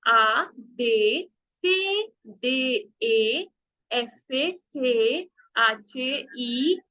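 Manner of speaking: very slow, each letter drawn out; slow teacher's drill, letters stretched apart; slow letter recital. Shown as a demonstration. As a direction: spell the alphabet slowly for pupils to copy. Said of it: "हम करते हैं अब ए से".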